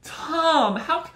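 loud, high, cut-off wife shriek; wife shrieking at her husband; shrill wife cry. Retold or imitated. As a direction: wail it like a horrified wife. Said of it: "and then she's like".

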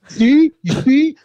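high, loud told-you-so; gloating. Retold or imitated. Mick gloating to the band, high and loud. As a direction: crow it high and loud like being proven right. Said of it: "And he goes".

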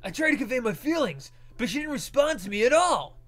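high, loud complaining character; whiny boy voice. Acted. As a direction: whine it out loudly.

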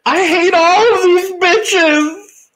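hammed-up hateful wail, high and slow; Brittany. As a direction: draw it out in a high mock tantrum. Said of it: "she hates everybody in there".

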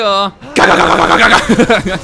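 loud growling ga ga; monster noises. Pretend. Playing a skull villain chasing kids, monster noises. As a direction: scare the children like a monster.

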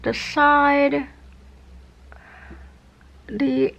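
lips held wide open; speaking with stretched lips; through cheek retractor. Real, not acted. Seen 4: Plastic cheek retractor holds lips wide open throughout the speech.

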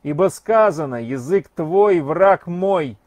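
loud declaiming. Acted. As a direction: proclaim it loudly so the street hears. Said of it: "громко говорил он".

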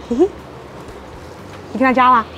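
usual speaking voice

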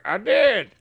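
quick high puppet interjection; puppet's retort. Acted. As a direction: pipe up as the puppet. Acting out a puppet's line.

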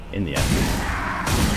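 slow, hushed "In the end." said as the fix for "for the end"; corrected phrase. Shown as a demonstration. The gamer correcting his own slip "In the end" slowly and softly. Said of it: "For the end?".

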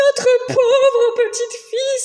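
high, loud wailing cry; sobbing parents. Acted. Parents grieving their daughter, high loud wailing. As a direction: wail in despair over the lost child. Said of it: "sanglotèrent les parents".

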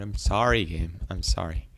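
ordinary delivery

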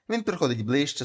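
ordinary delivery, nothing marked

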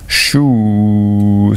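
long, loud "shoo" held on one note; drawn-out "shoo". Acted. Daddy trying out the end sound for Taffy, drawn out. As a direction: stretch the vowel right out, testing the sound. Father sounds out the new noise.

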